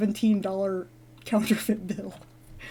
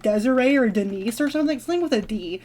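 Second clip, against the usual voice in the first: ordinary talk, nothing marked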